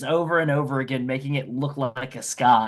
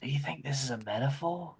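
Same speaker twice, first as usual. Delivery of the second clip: much softer, confidential aside; whispering aside; 10 dB quieter than usual. Acted. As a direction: lean in and ask under your breath. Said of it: "like, whispers to Tim".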